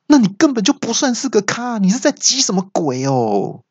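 high-pitched exasperated telling-off; high, huffy machine voice; scornful scolding. Acted. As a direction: berate the driver in mock indignation. Angry navigator scolds driver.

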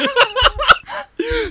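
high, loud burst of laughter. Real, not acted. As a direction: laugh out hard.

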